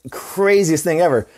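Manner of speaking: normal talking voice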